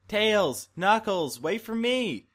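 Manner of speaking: high, loud call across the street. Acted. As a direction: shout after the departing bus. Calling friends at the bus.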